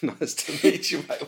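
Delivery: a laughing, lightly put-on Australian accent; Australian accent breaking into laughter; mock Aussie accent. Dad repeating his greeting, laughing, in Australian accent. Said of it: "That's a bad accent".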